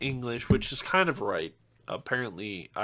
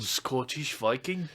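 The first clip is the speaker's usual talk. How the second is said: put-on Scottish accent; 2 semitones lower, 8 dB quieter, slower than usual. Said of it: "I can't do an accent".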